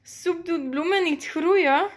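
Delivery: slow, high, loud teasing; amused disbelief, drawn out; spluttering amusement. A narrator's reading. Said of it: "Nelly proest het uit".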